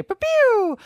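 high-pitched "pew, pew" laser zaps; playful vocal laser effect; laser sound. Shown as a demonstration. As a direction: imitate a little laser gun firing. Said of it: "that's my laser productivity sound".

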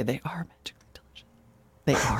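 whispered confession, voiced softly. Real, not acted. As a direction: hush it like a guilty secret about cereal. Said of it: "confessing cereal craving".

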